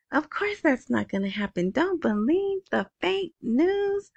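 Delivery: sarcastic parody of an official denial; mock official denial.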